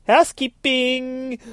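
high, drawn-out falsetto chirp; singsong housekeeping call. Acted. Assassins arriving from behind, high singsong call. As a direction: trill it like a maid at the door.

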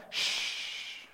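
long, voiceless hushing sound; rebuking shush. Acted. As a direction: hush someone in the crowd. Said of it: "The people rebuke him".

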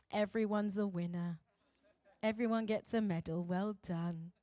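low, soft, soothing mock praise; primary school teacher. Primary school teacher consoling all the pupils, mock-soothing. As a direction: placate every child evenly. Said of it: "think about primary school".

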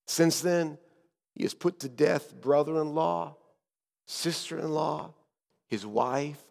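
soft, hushed account with pauses; whispered tones. Acted. Guide telling Azariah what Herod has done, hushed. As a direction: confide the grim history under your breath. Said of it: "And in whispered tones, I said".

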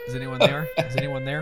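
high, wary call into the dark; nervous guard checking a noise; suspicious guard. Acted. Spooked caller in dark room.

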